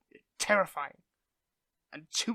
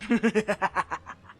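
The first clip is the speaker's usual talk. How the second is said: loud evil villain cackle; villain laugh; 2 semitones higher, 8 dB louder than usual. Acted. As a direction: laugh like a gloating supervillain. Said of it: "practicing my villain laugh".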